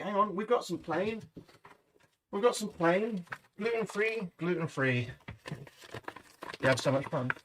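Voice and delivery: ordinary delivery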